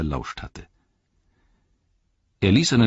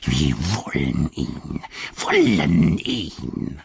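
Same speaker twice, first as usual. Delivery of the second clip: Gollum voice; 4 semitones lower, much slower than usual. Acted.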